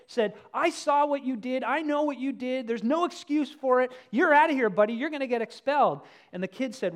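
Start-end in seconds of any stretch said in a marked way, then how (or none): 0.5-6.0 raised, scolding voice of an angry principal; high, loud, stern principal. Retold or imitated. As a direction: collar the kid and tell him off loudly. Principal collars kid after punch.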